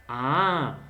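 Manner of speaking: normal talking voice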